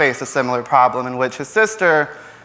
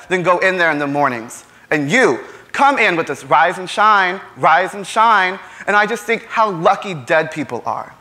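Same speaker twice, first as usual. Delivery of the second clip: higher-pitched, indignant stage tirade; Tom, exasperated and defiant; 5 semitones higher than usual. Acted. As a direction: argue back bitterly, flinging out incredulous questions and sarcastic confessions. Plays Tom arguing with his mother.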